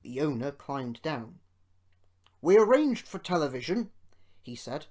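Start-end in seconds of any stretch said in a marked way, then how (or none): 2.4-3.9 loud, raised public address; booming speech-maker's delivery; proud announcement. A narrator's reading. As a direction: announce it to a crowd. Owner addressing the crowd proudly.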